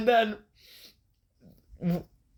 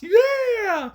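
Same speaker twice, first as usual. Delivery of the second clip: a sudden, much higher, louder yell; crowd cheer; 4 dB louder than usual. Retold or imitated. Imitating the crowd erupting as Yeager pulls his straps down. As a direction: pop like a hyped crowd.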